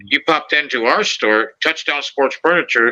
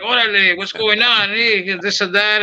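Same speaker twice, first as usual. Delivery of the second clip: higher Chicano street slang, slowed; lightly imitating Chicano street talk; 7 semitones higher than usual. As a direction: lay on the street greeting. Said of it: "started talking street".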